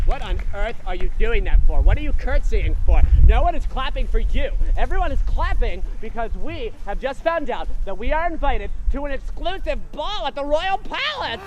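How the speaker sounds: loud, mocking bully; sneering stepsister. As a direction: jeer like a cruel sister. Stepsister scolds Cinderella.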